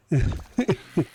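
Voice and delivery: short high giggle; amused chuckle at own impression; laughing. Real, not acted. Streamer amused by the Simpsons bit, short high laugh.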